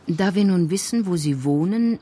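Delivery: ordinary talk, nothing marked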